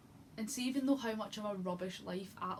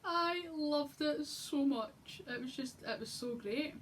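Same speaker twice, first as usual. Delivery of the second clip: very slow, much higher, drawn out; swooning gush; 7 semitones higher, slower than usual. Real, not acted. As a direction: melt over every word. Gushing about favourite scene.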